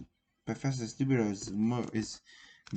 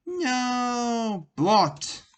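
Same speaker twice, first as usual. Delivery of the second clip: sound effect; 10 semitones higher, 4 dB louder than usual. A narrator's reading. Machine fires, sound effect.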